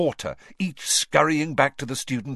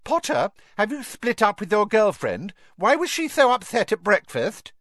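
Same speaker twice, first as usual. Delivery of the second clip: put-on much higher, louder sneering girl; taunting girl voice; 12 semitones higher, 5 dB louder than usual. A narrator's reading. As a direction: call out a spiteful taunt. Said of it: "Pansy called".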